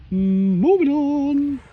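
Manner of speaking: high and very slow, stretched "moving on"; sing-song catchphrase. Acted. Shrugging off the fake shilling to viewers, slow sing-song. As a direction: croon it like a little jingle.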